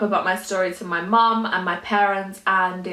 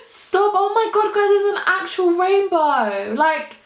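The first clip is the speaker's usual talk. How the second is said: higher, slowing, amazed exclaim; real giddy disbelief; awestruck excitement; 9 semitones higher than usual. Real, not acted. Overjoyed at the rainbow.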